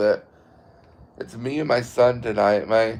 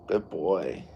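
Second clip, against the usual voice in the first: soft praise to the dog; 5 semitones lower, 9 dB quieter than usual. Real, not acted. Owner calming his dog, soft and low. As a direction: praise the dog gently and quietly.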